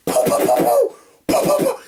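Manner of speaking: very high, sharp pow pow; squeaky imitated gunshots; gunfire sounds. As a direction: mimic rapid paintball shots. Imitating paintball gun fire.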